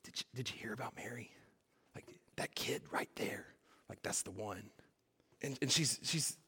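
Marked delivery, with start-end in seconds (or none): 0.0-4.7 hushed, fast whispered gossip. Retold or imitated. Townspeople whispering fast about Mary. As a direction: whisper it like town gossip behind her back. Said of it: "with everybody whispering".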